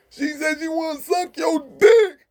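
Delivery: high, loud, slow deaf voice; high, slow, loud deaf voice yelling the message; deaf friend yelling. Retold or imitated. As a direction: bellow it high for the whole tier.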